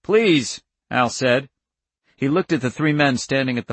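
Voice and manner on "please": raised, high-pitched plea; urgent plea. A narrator's reading. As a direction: beg him, voice up and loud. Pleading with him in the standoff.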